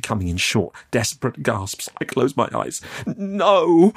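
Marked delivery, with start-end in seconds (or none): 3.0-3.9 high, loud anguished cry; sobbing. Acted. Youth begs after the captor's threat, sobbing. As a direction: wail it out in terror. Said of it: "I sobbed".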